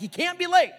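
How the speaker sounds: high, loud exasperated burst; exasperated scolding. Retold or imitated. Dad to daughter about lateness, exasperated. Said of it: "But this, I'm like".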